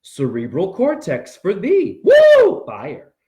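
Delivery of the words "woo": high, loud woo; excited whoop. Real, not acted. Hyped preaching cry.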